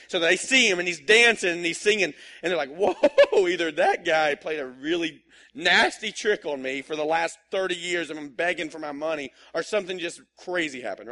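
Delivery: loud astonished retelling, with a laugh; amazed onlookers, laughing; excited amazed crowd. Retold or imitated. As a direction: tell it like you can't believe your eyes. Crowd amazed by healed man.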